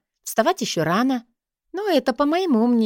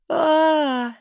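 yawning; 8 semitones higher, 3 dB louder than usual. A narrator's reading. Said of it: "зевая".

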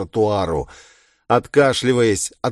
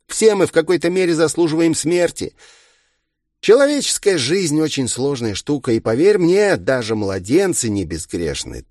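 higher-voiced villain lecturing lightly; philosophizing villain; 7 semitones higher, 3 dB louder than usual. A narrator's reading. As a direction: lecture with smug, mild condescension.

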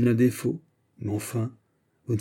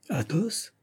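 a startled call, higher; calling out a name in surprise; surprised cry; 8 semitones higher, 5 dB quieter than usual. A narrator's reading. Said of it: "s'écria d'Artagnan". (appel surpris, plus aigu)

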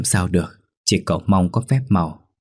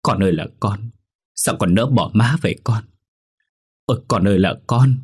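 mother's grieving cry, mildly acted; understated wail over a dead child; sobbing lament; 2 semitones higher than usual. A narrator's reading. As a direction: mourn aloud with a touch of a sob. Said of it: "ôm xác con khóc nấc lên".

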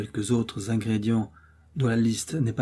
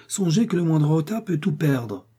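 urgent plea; 6 semitones higher than usual. A narrator's reading. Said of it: "s'écria Milady".

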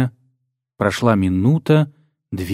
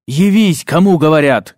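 high, loud commanding bark; 4 semitones higher, 4 dB louder than usual. A narrator's reading. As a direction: bark the order at full force. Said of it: "гаркнул Даррентл".

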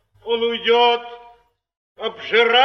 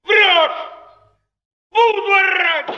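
defiant shout; 8 semitones higher than usual. Acted. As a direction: shout him down. Actor defies the baron, shouting.

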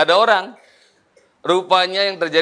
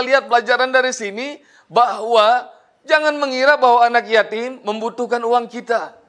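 ordinary talk

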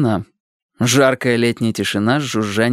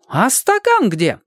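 put-on much higher, slow fussy kid; fussy boy voice; 13 semitones higher, slower than usual. A narrator's reading. Boy demands a glass to drink from, high and fussy.

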